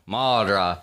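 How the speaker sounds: Muldrow the local way, loud, ending as "Maldrah"; local drawl. Shown as a demonstration. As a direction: say MULDROW like the locals, loud, last syllable opened to "ah". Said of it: "as they would say it down there".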